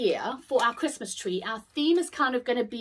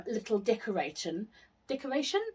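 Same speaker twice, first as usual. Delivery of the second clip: ordinary talk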